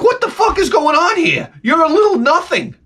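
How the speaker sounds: high shouted outrage at a small dog; mock shout at dog. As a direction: yell it down at a tiny dog. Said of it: "I'm like".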